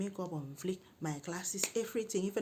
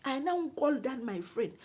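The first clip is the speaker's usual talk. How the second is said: ordinary talk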